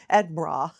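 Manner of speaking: "Edinburgh" squashed to ED-brah; self-mocking "Edinburgh" ending in a broad brah; botched own pronunciation. Shown as a demonstration. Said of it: "mine kinda comes out bra".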